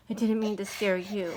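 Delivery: ordinary talk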